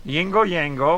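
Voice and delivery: put-on bogus Swedish accent. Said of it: "I'm gonna drop this bogus accent".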